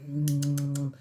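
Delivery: usual speaking voice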